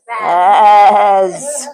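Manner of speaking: low booming yes from the rat; deep rat voice. Acted. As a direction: boom it out as the rat. Rat answers loudly in story.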